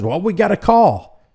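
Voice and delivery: high-pitched mimicry of the officer; mocking officer impression. Host mocking the officer's excuse, mocking impression. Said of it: "listen to what she says".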